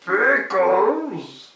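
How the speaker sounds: a fat goblin surprised; big goblin. Acted.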